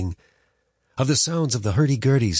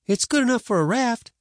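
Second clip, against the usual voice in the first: put-on higher stubborn boy; boy's voice. A narrator's reading. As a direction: protest like a boy. Boy protesting.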